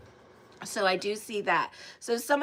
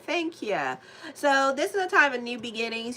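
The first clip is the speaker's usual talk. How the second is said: ordinary talk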